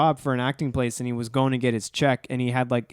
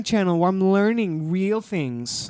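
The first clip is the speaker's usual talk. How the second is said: gullible naive voice; 8 semitones higher, 3 dB louder than usual. Pretend.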